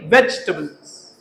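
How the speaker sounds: वेजिटेबल्स as "VEJ-tuh-bulz", low and slow; reduced vegetables pronunciation. Shown as a demonstration. Teacher after rejecting "veji", वेजिटेबल्स as "VEJ-tuh-bulz". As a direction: drop the "i" of वेजिटेबल्स. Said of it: "वेज ही नहीं है".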